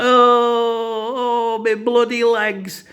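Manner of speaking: high, loud, drawn-out wail of pain; imitated agonised scream; mock screaming. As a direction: howl it like an injured man, hammed up. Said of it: "He was there screaming on the floor".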